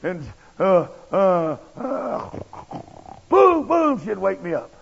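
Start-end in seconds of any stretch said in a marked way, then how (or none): 3.3-4.0 loud, high "boom, boom" like a banging knock; mock pounding noise. Imitating pounding that woke him.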